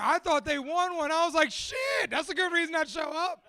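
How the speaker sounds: high-pitched, animated explaining; loud, excited outburst. Real, not acted. Host explains the mishearing to the room, excited outburst. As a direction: leap way up on the exclamation, keep it high.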